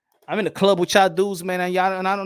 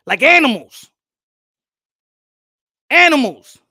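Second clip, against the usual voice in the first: angry; 7 dB louder than usual. Real, not acted. Ranting at the audience.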